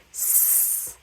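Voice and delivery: "S" as a long hissed s; sustained sss for "S"; cedilla sound. Shown as a demonstration. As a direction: demonstrate "S" as the sibilant, not the letter name. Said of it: "gonna be pronounced".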